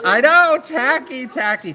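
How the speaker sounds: high, loud burst of self-mockery; campy, high-pitched exclamation; mock dismay. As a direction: cry out in hammed-up horror at your own bad taste. Mock dismay at own childhood outfit.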